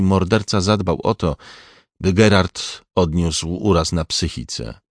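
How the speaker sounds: ordinary delivery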